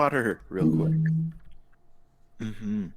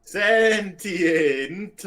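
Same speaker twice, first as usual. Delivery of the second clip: insistent; 6 semitones higher, 3 dB louder than usual. Real, not acted. Insisting again.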